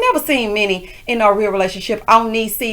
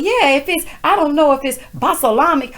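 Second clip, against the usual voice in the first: 1 quoting Juicy; 6 semitones higher than usual. Retold or imitated. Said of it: "She's like".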